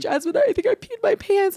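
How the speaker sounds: high-pitched mock distress; worried confession. High woman tells her friend of an accident, mock worry.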